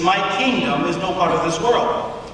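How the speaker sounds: ordinary talk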